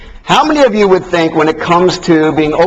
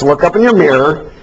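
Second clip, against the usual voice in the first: ordinary talk, nothing marked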